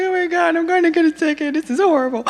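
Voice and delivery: high, distressed wail, retold; panicked and upset. Driver after her car accident fearing a ticket, upset high voice. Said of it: "I'm crying, and I'm like".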